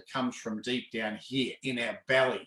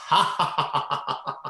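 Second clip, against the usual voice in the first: loud chest laugh, ha ha ha; hearty laughter demo; 5 dB louder than usual. Shown as a demonstration. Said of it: "and go".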